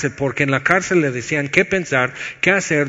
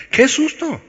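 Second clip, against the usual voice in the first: mock fright; 11 semitones higher than usual. Mock fright at harsh verse.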